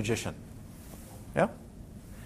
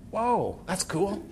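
mock amazed whoa; 4 semitones higher, 5 dB quieter, slower than usual. Distracted student marvels at picture.

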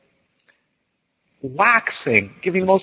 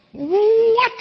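shrill, very high indignant yelp; imitating a startled teacher; incredulous what; 3 dB louder than usual. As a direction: shoot the word up in disbelief. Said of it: "He goes".

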